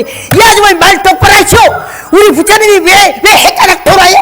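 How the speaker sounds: shouted high and loud, outraged; angry shout. Retold or imitated. Monk shouting angrily at the preacher woman. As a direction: yell back in outrage at the woman. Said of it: "혈기를 내지 마시오".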